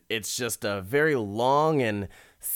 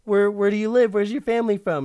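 higher and louder, gushing; flirty wife; 11 semitones higher, 8 dB louder, faster than usual. Retold or imitated. Wife flirting with the salesman, higher and gushing. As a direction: bat your eyelashes with the voice.

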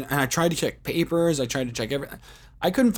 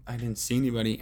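ordinary talk

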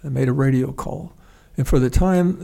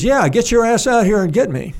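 high, loud radio call for rescue; 7 semitones higher, 4 dB louder than usual. Retold or imitated. Downed pilot yelling to his buddy over the radio, high and loud. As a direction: bark it at the helicopter pilot.

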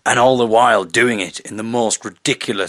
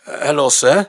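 Robert on the phone; 5 semitones higher, 5 dB louder than usual. Retold or imitated. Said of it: "so Robert comes to the phone".

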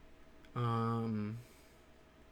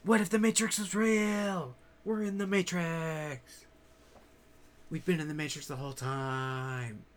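mock amazed outcry; 4 semitones higher than usual. Speaker playing someone realising they're in the Matrix, mock amazed. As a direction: cry out in pretend astonishment, easing off.

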